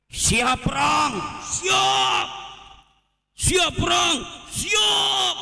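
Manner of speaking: high-pitched shouted rallying call; shouted call-and-answer war chant, mockingly; mock war cry. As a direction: imitate a rabble-rouser yelling into a mic. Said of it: "teriak-teriak".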